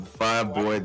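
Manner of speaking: ordinary talk, nothing marked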